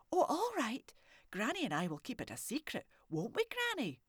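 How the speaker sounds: put-on high, bright child's voice; a curious little girl asking boldly; little girl Morag. Morag asking the old man if he's a haggis, high child voice.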